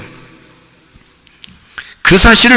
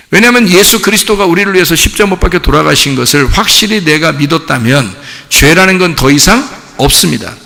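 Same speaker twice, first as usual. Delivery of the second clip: ordinary talk, nothing marked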